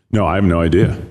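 ordinary talk

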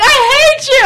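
high, loud mock-furious outburst; retold angry yell. Girl retells yelling at her best friend over a crush, mock yell. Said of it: "I yelled at Chandler".

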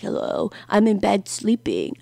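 soft, whispery put-on drowsy voice; imitating a boyfriend pretending to be half asleep; fake sleepy boyfriend. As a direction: play the boyfriend faking that he just woke up. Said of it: "pretends to be all sleepy".

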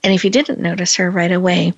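ordinary delivery

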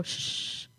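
shushing. Pretend. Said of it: "I'm gonna go".

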